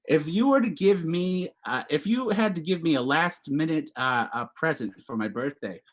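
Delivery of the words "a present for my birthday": ordinary delivery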